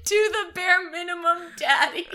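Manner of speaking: high, laughing repeat of the joke; giggling through the words. Real, not acted. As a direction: crack up mid-line.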